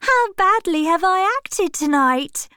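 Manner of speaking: put-on a carefree young actress, playfully asking; Sybil, cheerful. Sybil asking Dorian about her bad acting, high and merry.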